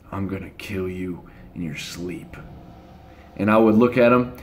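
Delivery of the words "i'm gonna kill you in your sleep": low, much softer sinister murmur; a menacing little brother's threat, hushed low; devilish threat. Retold or imitated. Brother threatening him in bed: hushed devilish threat. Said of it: "devilish look".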